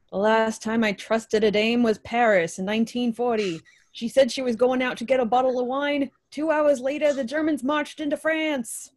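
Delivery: deadpan noir delivery, lightly put on; hard-boiled detective. Quoting the detective's line to his co-host, mock noir.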